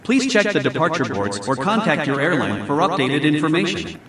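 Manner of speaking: airport announcement. Shown as a demonstration.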